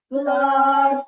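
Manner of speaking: loud, sung-out call of Flossie. Acted. Big Mama calling Flossie home, a loud sung call. Calling out book's first word.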